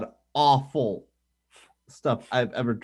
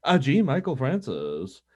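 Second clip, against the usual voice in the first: usual speaking voice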